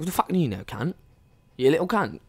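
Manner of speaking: lightly put-on British accent catchphrase. Storyteller repeats his fake-Brit sign-off, put-on British accent. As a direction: toss off the insult in mock British. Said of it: "That's how I ended every sentence".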